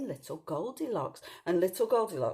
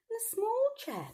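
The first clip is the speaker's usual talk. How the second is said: much higher small voice; tiny voice. A narrator's reading. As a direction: make the word small sound tiny. Small chair for Baby Bear.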